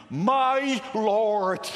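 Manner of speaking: emphatic, drawn-out invocation; calling on God. Acted. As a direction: call out the name with full force. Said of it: "call upon his name".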